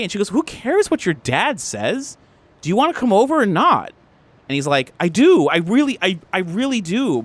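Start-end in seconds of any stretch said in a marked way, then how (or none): 0.3-3.9 high, stretched-out indignation; fed up, pitch swinging high; exasperated girlfriend. Acted. Girlfriend snaps in acted quarrel. 5.0-7.2 higher, insistent reassurance; acted lover's plea, raised pitch; pleading boyfriend.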